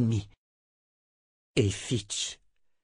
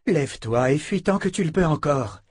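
higher, pressing woman's voice; urgent rescuer; 6 semitones higher, 4 dB louder than usual. A narrator's reading. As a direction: hurry the girl away. Rescuer urges Beata. (la femme en rouge presse Beata de fuir)

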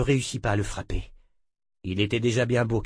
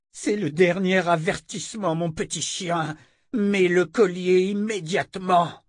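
high, hard, demanding voice; commanding woman; 8 semitones higher, 3 dB louder than usual. A narrator's reading. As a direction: order him about coldly. Callan final threat.

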